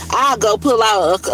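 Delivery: ordinary talk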